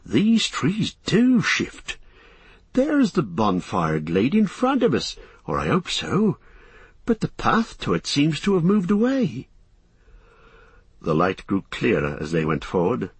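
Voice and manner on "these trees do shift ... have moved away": relieved wonder. A narrator's reading. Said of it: "gave a whistle of relief".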